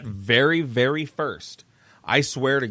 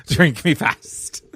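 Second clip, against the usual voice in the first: laughing; 3 semitones higher, slower than usual. Real, not acted. Repeats with laugh.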